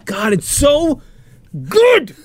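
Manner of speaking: so good, nearly squealing; gushing. Real, not acted. Raving about the movie.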